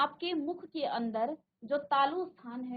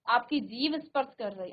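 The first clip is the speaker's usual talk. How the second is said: usual speaking voice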